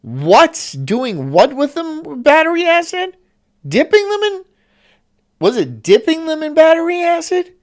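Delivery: can't believe what he just heard; incredulous high-pitched questions; shocked disbelief. Real, not acted. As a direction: voice shooting way up in disbelief.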